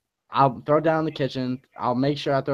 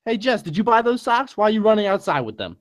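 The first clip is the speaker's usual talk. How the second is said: mock parental scolding, pitched up; play-acted telling-off in a higher voice; yelling parent; 7 semitones higher than usual. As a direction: question the kid like an exasperated dad. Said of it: "that's why he's yelling at you".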